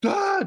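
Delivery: short, high, loud crowd scream; panicked scream. Acted. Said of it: "panicking and start running".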